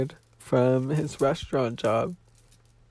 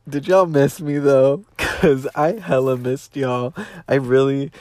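louder, playful call to the fans; excited callout; 2 semitones higher, 6 dB louder than usual. Real, not acted. Returning vlogger greets fans, louder and excited. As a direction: shout it out happily to the viewers.

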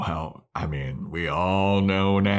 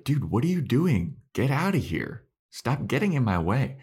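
higher-pitched, mock-exasperated telling off; 5 semitones higher than usual. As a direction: act out an annoyed brush-off. Acted quote, Willow annoyed at Xander.

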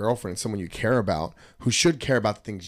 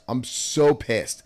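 usual speaking voice

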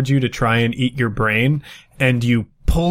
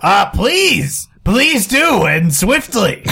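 high, urgent put-on voice; pleading character; 8 semitones higher, 4 dB louder, slower than usual. Trapped character begging adventurers to break in, pleading. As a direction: beg them from up high.

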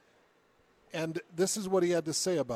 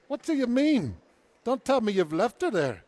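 put-on higher, accented burst of alarm; indignant disbelief; 6 semitones higher, 4 dB louder than usual. Son confronts hospital about his mother, indignant. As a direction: demand an answer in rising disbelief. Acts the son's alarmed reaction.

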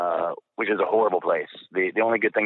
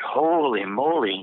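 slow, drawn-out exclamation, pitched up; retold amazement; 5 semitones higher, slower than usual. Airman learning of the planes on nine eleven, retold amazement. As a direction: stretch out both words in wonder.

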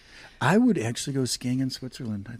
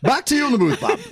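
high, loud TV sports sign-off; breathless sideline reporter; 10 semitones higher, 7 dB louder, faster than usual. Pretend. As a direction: hand it back to the booth. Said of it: "That's gonna be your closeout".

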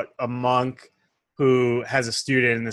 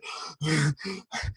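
gasp for air; 3 semitones higher, 7 dB quieter than usual. Acted. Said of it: "he gasps for air".

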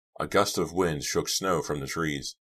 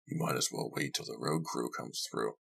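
lower, softer man's voice, dismissive; host, discouraging; 4 semitones lower, 9 dB quieter than usual. Acted. Host telling guest to wait for the crew, low and discouraging. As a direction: talk him out of it in a low voice.

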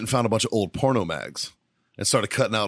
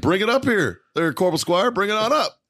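officer summoning; 10 semitones higher, 3 dB louder than usual. Retold or imitated. Colonel summons corporal forward.

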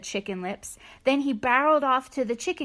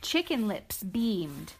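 ordinary delivery, nothing marked

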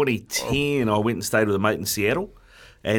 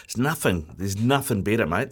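usual speaking voice

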